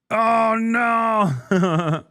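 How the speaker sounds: loud, high hammed-up alarm; mock panic. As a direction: cry out in fake fright. Mock panic about the French.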